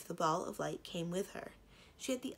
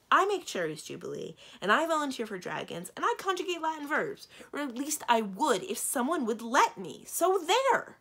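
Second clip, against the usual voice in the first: indignant outburst climbing high and loud; snapping; 8 semitones higher, 8 dB louder than usual. A narrator's reading. Princess defending herself to doubting dragons, increasingly indignant. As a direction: build a defiant tirade to a high, loud finish. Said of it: "Simrine snapped".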